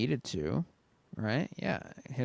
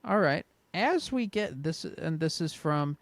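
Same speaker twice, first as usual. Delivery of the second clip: normal talking voice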